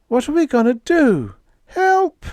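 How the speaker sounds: high, loud cry for help; a crew member screaming for help; panicked cry. Acted.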